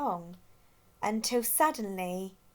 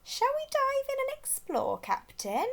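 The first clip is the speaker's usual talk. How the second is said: put-on much higher, light girl penguin voice; eager penguin. A narrator's reading. Rossi asking her captain to dive, eager and high. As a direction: ask the captain brightly.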